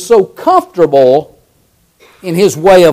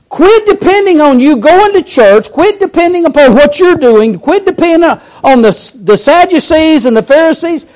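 full-force preaching; 9 semitones higher than usual. Acted. Preacher urging the congregation, full-force preaching. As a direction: preach it out to the church.

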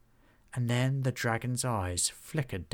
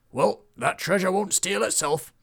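higher, louder swaggering troll voice; bold troll warrior; 9 semitones higher, 6 dB louder than usual. Acted. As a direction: brag like a reckless troll hero. Troll boasting before charging in.

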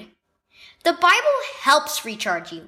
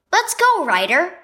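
higher, louder call across the camp; 7 semitones higher, 4 dB louder than usual. Acted. Keegan calling his little brother, raised call. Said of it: "he called to his little brother".

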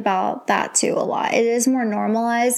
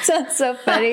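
laughing; 2 semitones higher than usual. Real, not acted. Laughing at the joke.

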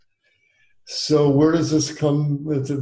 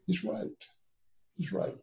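hushed, near-whispered admission; little inner voice; 5 semitones higher, 14 dB quieter than usual. Retold or imitated. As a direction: whisper it like a small voice of conscience. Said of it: "that little voice on my shoulder said".

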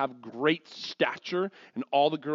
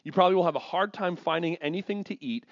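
ordinary talk

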